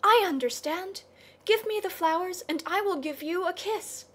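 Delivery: put-on high, eager girlish cry. A narrator's reading. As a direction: burst out with delight, pitched high.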